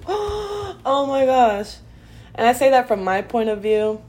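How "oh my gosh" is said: laugh-crying. Real, not acted. Laughing through tears over past.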